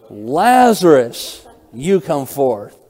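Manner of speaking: Lazarus drawn out slow and loud, then a firm you come forth; loud command. Shown as a demonstration. Preacher acting Jesus calling Lazarus from the tomb, loud slow command. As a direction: call him out of the tomb with full authority, slow and ringing. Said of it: "Jesus is commanding with a loud voice".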